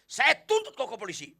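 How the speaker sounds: loud, high shouted threat; furious threat. Acted. Customer threatening to take the salesman to the police, shouting. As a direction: threaten the salesman at full volume. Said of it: "Makin naik darah Anda".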